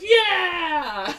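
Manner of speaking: loud, high crowd whoop; cheer. Acted. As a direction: cheer them on. Kids cheer at meeting.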